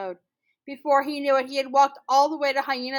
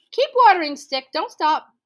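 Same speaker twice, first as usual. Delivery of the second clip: high, loud command to the stick; loud order; 7 semitones higher, 10 dB louder than usual. Acted. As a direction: call it out loudly.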